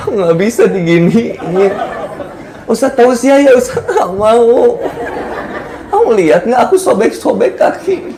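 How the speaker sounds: exaggerated, comic poor-me complaint; overdramatic mock whining. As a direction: play up the injury melodramatically. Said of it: "Lebay nantinya".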